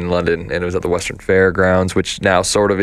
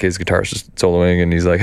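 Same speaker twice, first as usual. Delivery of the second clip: ordinary talk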